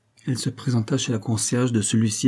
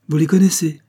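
higher, louder, thrilled question; the delighted concierge asks Rose eagerly; 7 semitones higher, 6 dB louder than usual. A narrator's reading. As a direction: burst out with delight. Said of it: "fit la concierge enchantée".

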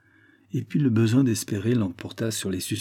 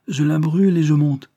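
raised, louder call up to a friend; higher, louder shouted-back reply; 6 semitones higher, 5 dB louder than usual. A narrator's reading. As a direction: call it out briskly, pitched higher. Irishman calls up to his friend.

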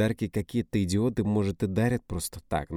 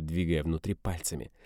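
ordinary delivery, nothing marked

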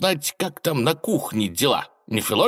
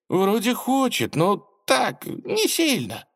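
high, slow hesitant voice; nervous minister; 12 semitones higher, slower than usual. Acted. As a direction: squirm and hedge nervously. Министр оправдывается. (робко, неуверенно)

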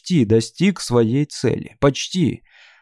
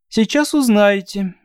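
chirpy thin voice; 8 semitones higher than usual. Acted. Shatra's voice continued.

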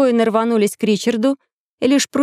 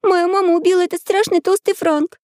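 put-on high-pitched little girl's voice; 8 semitones higher than usual. A narrator's reading. Girl accusing the count before the king, high child's voice. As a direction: pitch it right up like a small child. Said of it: "сказала девочка".

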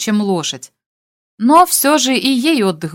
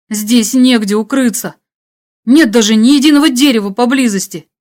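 louder, agitated protest; tense, exasperated radio voice; hunted hero, alarmed; 3 semitones higher, 5 dB louder than usual. Acted. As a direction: list the dangers with rising worry. Hunted hero despairs under threat.

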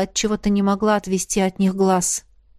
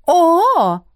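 high, louder intrigued "oh"; interested; 10 semitones higher, 6 dB louder than usual. A narrator's reading. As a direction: perk up with sudden interest. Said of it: "заинтересовалась она".

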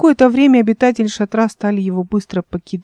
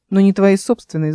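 ordinary delivery, nothing marked

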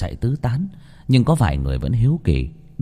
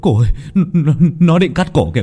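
loud, stammering alarm; raised, urgent cry of terror; panicked shouting; 7 semitones higher, 5 dB louder, faster than usual. A narrator's reading. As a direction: shout a frantic warning into an ear. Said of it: "như muốn hét vào tai".

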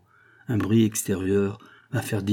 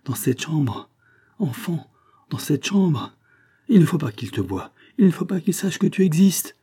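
high, urgent orders building louder; alarmed exclamation; 7 semitones higher, 3 dB louder than usual. A narrator's reading. Mother hiding her child from the visitor, alarmed. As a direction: hustle him away in rising panic. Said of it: "s'écria André".

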